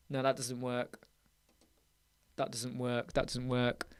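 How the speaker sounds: low, very soft mutter; hushed, low self-talk. Real, not acted. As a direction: mumble it under your breath while troubleshooting. Muttering to self while troubleshooting.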